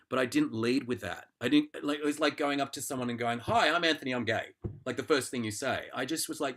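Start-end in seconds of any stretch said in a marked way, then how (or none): 3.4-4.5 higher, louder opening hello, then dropping back; mock eager introduction. Going up to someone to introduce himself, mock eager. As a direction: announce yourself to a stranger, a touch overdone.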